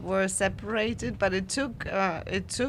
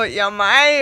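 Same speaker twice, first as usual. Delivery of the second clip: louder, exaggerated Bavarian German; teasing Bavarian drawl, said loud; mock Bavarian accent; 12 dB louder than usual. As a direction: send up a Bavarian dialect speaker. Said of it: "taking the mickey out of the Bavarian accent".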